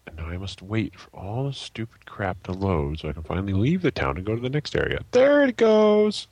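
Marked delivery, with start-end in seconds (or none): 5.1-6.3 high, loud, drawn-out cry; stretched, high-pitched exclamation; exclaiming. Real, not acted. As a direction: call it out slowly, pitched way up. Game finally finishes loading.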